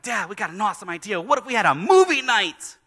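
put-on high, loud eager kid voice; excited kids. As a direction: kids bursting with their big idea. Kids pitch a movie night.

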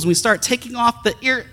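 usual speaking voice

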